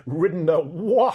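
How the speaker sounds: breaking into a laugh mid-line; laughing repeat. Acted. Reader repeats the horse's line, laughing. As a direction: reader cracks up re-saying it.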